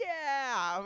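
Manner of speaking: high, loud cheer; excited little kids. Retold or imitated. Friends answering the costumed guy like little kids, excited yell. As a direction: yell it like thrilled children. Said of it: "It's just like little kids".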